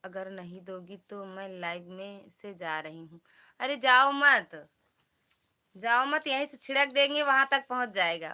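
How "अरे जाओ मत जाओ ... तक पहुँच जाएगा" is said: loud, high-pitched plea to stay; calling out. Real, not acted. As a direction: call out loudly to stop someone leaving. Calling back viewer threatening to leave.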